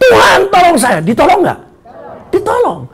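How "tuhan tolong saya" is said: loud, higher cry for help. Acted. Peter, sinking, calls to God for help, crying out. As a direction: call out urgently to be saved. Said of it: "saudara dia teriak".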